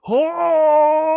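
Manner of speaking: sudden high strangled yell; a short mock Musk shriek; Elon Musk yell. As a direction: let out a wild shrill cry. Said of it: "can you figure out how to do the Elon Musk yell".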